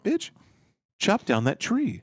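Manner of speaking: firm commanding order; with authority. Shown as a demonstration. Showing how to command the wife, with authority. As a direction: say it like you own the place. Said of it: "You have to say it with authority".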